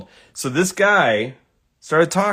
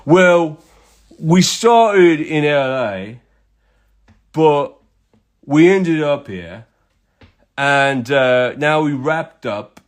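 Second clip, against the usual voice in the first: slow, drawn-out mock English accent; terrible English accent; 3 semitones higher, slower than usual. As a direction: imitate an Englishman explaining himself, unhurried. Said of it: "do my terrible English accent for this".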